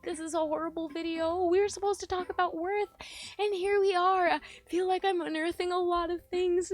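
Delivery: high, loud, upset outpouring; distressed. Real, not acted. Dreading posting the video to viewers, distressed.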